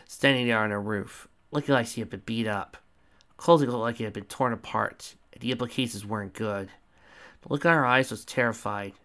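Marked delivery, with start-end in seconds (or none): none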